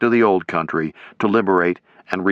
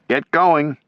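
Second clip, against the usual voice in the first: yelled order; 7 semitones higher than usual. A narrator's reading. Commander yelling his men onto the bridge. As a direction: push the men forward. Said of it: "Timmerman yelled".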